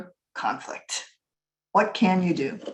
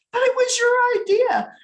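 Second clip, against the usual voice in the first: much higher, louder, indignant grandpa; grandpa blaming; 5 dB louder than usual. Retold or imitated. Grandpa telling grandma it was her idea, high and loud. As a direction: throw the blame back at her.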